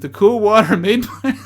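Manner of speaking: breaking into laughter. Real, not acted. Breaks up mid-quote.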